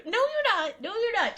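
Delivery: loud, high-pitched refusal; insistent scolding. Real, not acted. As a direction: forbid it firmly, twice over. Scolding chat's reckless plan.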